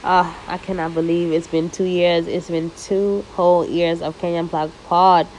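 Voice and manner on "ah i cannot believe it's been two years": normal talking voice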